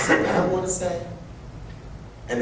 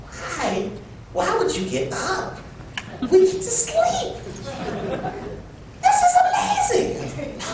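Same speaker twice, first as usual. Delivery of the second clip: put-on higher and higher, gleeful kid voice; childish excitement. Young self telling friends a day off is amazing, rising giddy. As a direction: climb up into a delighted child's squeal. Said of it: "I'm like".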